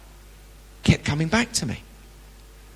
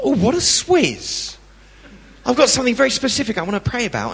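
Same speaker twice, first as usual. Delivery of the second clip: mock indignation; 8 semitones higher than usual. Inner voice, annoyed thought.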